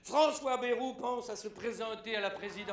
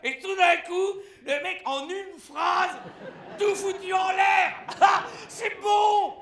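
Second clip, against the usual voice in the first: shouted much higher and louder, slowing down; mock outburst; 8 semitones higher, 10 dB louder, slower than usual. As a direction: explode with comic exasperation. Récit comique, explosion de colère. (cri exaspéré comique)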